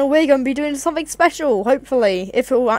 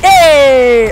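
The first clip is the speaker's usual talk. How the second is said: loud protest; 9 dB louder than usual. Real, not acted. Attacked in game.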